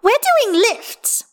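put-on very high, loud, gleeful child; happy yell. A narrator's reading. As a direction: shout it slowly in delight. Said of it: "Hestia yells happily".

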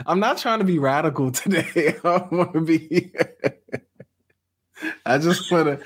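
amused, half-laughing disclaimer. Real, not acted. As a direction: laugh as you protest you're not radical. Laughing while disclaiming being radical.

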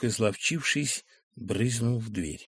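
ordinary delivery, nothing marked

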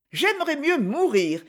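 a high defiant cry; exclaiming. A narrator's reading. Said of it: "s'écria-t-elle".

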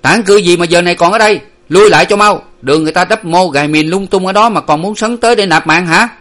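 loud, high angry scolding; harsh sentry's bawled warning; shouting back. A narrator's reading. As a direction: yell at them to get back. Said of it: "Có tiếng quát lại".

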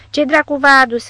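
loud, high demand; rough question. A narrator's reading. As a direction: bark the question in surprise. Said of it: "întrebă micuțul".

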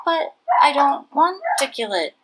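put-on a boy's plea fading sadly; sad trailing off. Cheng Ling pleading for the mouse, trailing off sadly. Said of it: "his voice trailing off sadly".

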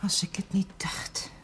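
breathy hushed knowing remark; scandalised whisper; gossip whisper. Acted. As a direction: whisper it like a confirmed suspicion. Gossiping about the chaplain.

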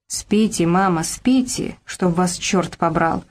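ordinary delivery, nothing marked

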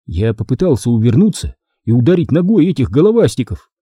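usual speaking voice

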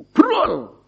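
sudden high "brol" of something spilling out; pouring-out sound. Retold or imitated. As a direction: pop the word out high, like a burst. The heart's feelings spilling out.

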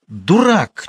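high cry on the edge of tears; near-tears hoarse shout. A narrator's reading. Girl screams at the man, nearly crying. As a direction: yell it, almost crying. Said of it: "чуть не плача, хрипло закричала Манька".

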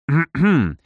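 doubtful throat clearing; сомневающееся покашливание. A narrator's reading. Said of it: "С сомнением в голосе кашлянул я". (покашлять с сомнением)

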